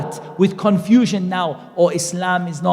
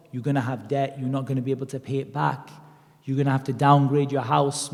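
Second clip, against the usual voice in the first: ordinary talk, nothing marked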